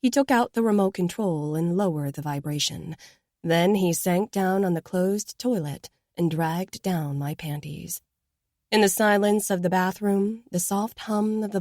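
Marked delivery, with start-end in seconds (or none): none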